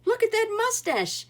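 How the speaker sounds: put-on aghast at the giant baby; loud high astonishment; amazed exclaim. A narrator's reading. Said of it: "exclaimed Cuchulainn".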